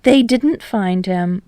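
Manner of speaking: ordinary delivery, nothing marked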